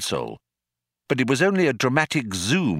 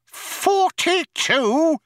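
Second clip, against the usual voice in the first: very high, drawn-out outraged yell; 4 dB louder, much slower than usual. A narrator's reading. Loonquall to the computer about its answer, outraged yell. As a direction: yell it in high-pitched disbelief. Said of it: "yelled Loonquall".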